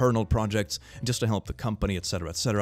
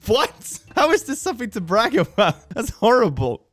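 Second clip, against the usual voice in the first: high, loud disbelief; shocked and appalled, voice jumping up; shocked outrage; 13 semitones higher, 7 dB louder than usual. Real, not acted. Reacting to a jail brag post.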